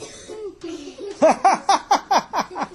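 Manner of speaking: high-pitched acted belly laugh; loud peals of high laughter; helpless laughter. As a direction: burst into high, uncontrollable cackling. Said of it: "He started laughing".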